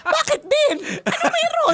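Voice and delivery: high, laughing flustered comeback; mock panic. Wife retelling her reply to panicking husband, mock panic. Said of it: "nataranta din ako".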